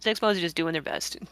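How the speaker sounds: normal talking voice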